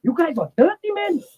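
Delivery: high-pitched mock mom scolding, a touch tearful; imitating his mom. Retelling his mom in the dressing room, high mock scolding. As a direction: squeak it like his mother telling the boys off. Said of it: "all my mom was saying was".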